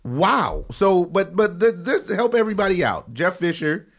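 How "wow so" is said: normal talking voice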